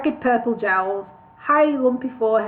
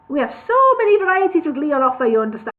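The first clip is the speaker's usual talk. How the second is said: fast nervous patter; 4 semitones higher, 4 dB louder, faster than usual. Acted.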